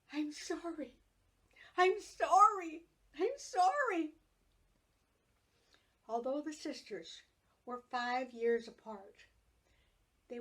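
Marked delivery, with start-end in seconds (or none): 0.1-4.1 rising into a loud wail; wailing apology. A narrator's reading. Little sister wailing to injured sister, building to a loud wail. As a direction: wail it louder each time. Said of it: "wailing from the front porch".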